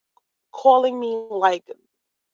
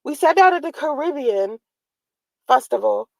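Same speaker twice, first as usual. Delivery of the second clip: usual speaking voice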